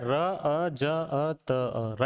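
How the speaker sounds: र, अ then ज, अ then त, अ, each sound said separately; syllables split apart. Shown as a demonstration. Teacher splitting रजत into sounds for students. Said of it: "कैसा है?".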